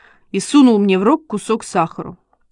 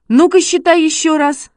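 high, loud eager command; excited shout; 7 semitones higher, 4 dB louder than usual. A narrator's reading. Boy testing his dog once more, shouting. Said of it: "закричал Шишкин".

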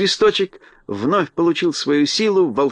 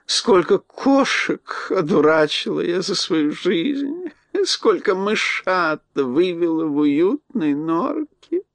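put-on very slow, higher mumbling old mouse; toothless mumble; 4 semitones higher, slower than usual. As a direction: savour each memory, mumbling toothlessly. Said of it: "прошамкала старушка беззубым ртом".

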